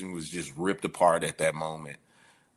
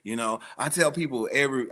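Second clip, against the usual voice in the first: usual speaking voice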